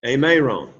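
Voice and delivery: usual speaking voice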